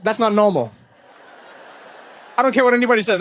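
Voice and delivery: ordinary talk, nothing marked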